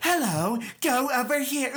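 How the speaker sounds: a light, silly robot voice; wacky robot voice. Pretend. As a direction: make the robot sound unhinged and fun. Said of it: "the other one just had some wacky voice".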